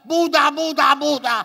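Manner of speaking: high, loud rapid cries to Buddha; comic last-second desperate shout; panicked switch. Acted. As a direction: frantically yell for the other god.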